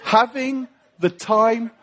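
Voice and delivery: slow, halting delivery of song lyrics; drawn-out, deliberate lyric with long pauses; hesitant lyric quote. Retold or imitated. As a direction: recite pop lyrics slowly as a solemn message. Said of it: "in the words of abba".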